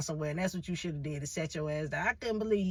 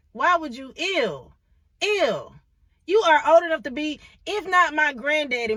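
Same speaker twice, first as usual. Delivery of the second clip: louder, higher "ew" of revulsion; disgusted outburst; 7 semitones higher, 7 dB louder than usual. Retold or imitated. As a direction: bark it out, grossed out and indignant. Disgusted rant at old man.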